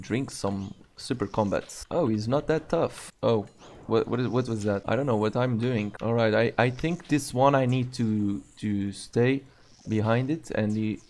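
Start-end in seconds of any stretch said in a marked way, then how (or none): none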